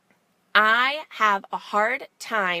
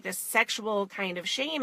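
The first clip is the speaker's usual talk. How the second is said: ordinary delivery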